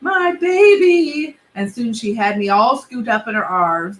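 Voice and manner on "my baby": high, slow, drawn-out cry of a doting mother; mom's cry. Acted. Mom running to scoop up her son, high and slow. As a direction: stretch it out like a smothering mom.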